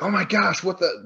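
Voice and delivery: high-pitched mock astonishment; imitated shock. Pat walking in on the caged man, imitated shock. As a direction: react as the stunned counselor, voice jumping up. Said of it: "Pat's like".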